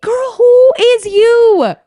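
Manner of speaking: high, slow, sassy drag. Real, not acted. Mocking the boyfriend, high slow sass. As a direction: stretch it out with sass. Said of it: "sassy high slow retort".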